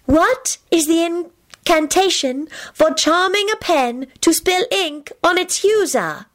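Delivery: high, slow, sweet card voice; clear, sweet voice. A narrator's reading. Enchanted card asking the players a charms question, clear and sweet. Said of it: "a clear, sweet voice echoed from the card".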